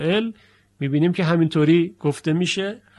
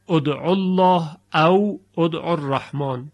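ordinary delivery

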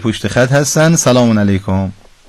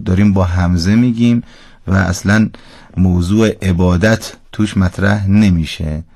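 usual speaking voice

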